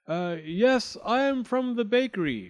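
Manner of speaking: high, over-assured fib; lying. A narrator's reading. He lies to the girl about the bakery, pitched high. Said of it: "Fleming Flinders lied in his teeth".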